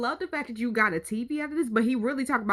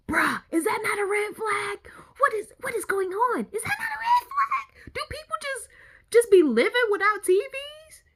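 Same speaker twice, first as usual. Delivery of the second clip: incredulous outburst; 8 semitones higher than usual. Real, not acted. Reacting to a house with no TV, incredulous outburst. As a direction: pitch climbing to a squeal.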